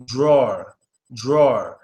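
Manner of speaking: drawer said as one vowel, tɹɔɹ, dropping the E; teacher model of "drawer" with a deep A; drawer without the E. Shown as a demonstration. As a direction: say "drawer" like draw with an r, low and falling. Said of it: "kinda just drawer".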